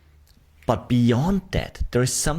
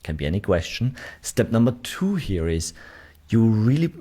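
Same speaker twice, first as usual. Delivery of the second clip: ordinary talk, nothing marked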